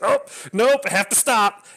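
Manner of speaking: high, loud, hammed-up fighting back tears; laughing at himself as he fakes choking up; mock choking up. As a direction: ham up holding back tears on the train. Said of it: "I'm trying not to cry".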